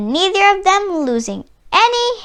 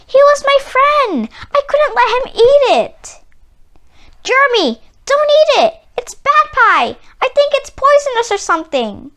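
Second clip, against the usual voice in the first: high, loud panicked warning; 9 semitones higher, 5 dB louder than usual. Acted. Boy warns friend not to eat the pie, high loud panic. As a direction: blurt it out to stop him in time. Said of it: "It was at the point that I panicked".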